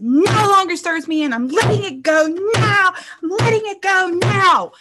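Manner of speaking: high, insistent yelling; forceful high-pitched cathartic scream; screamed declaration. Shown as a demonstration. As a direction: yell it out like banishing something. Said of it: "scream it really, really loud".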